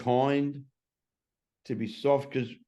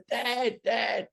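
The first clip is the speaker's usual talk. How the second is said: put-on much higher kid's call of Dad, Dad; imitating son calling; 12 semitones higher than usual. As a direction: call out like an eight-year-old boy at the door. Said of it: "he yelled out".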